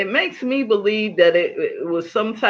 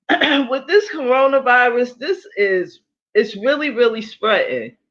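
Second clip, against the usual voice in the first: normal talking voice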